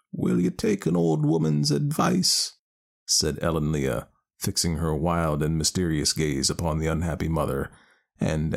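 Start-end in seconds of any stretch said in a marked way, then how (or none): 0.1-2.5 put-on high, slow wise old woman; a knowing village wise woman. Ellen Leah offers advice.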